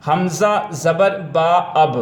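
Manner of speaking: slow, deliberate letter-by-letter reading; teacher slowly modelling حمزہ زبر با اب; spelling-out demo. Shown as a demonstration. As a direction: sound out حمزہ زبر با, then join it as اب. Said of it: "درست تلفظ کی ادائیگی".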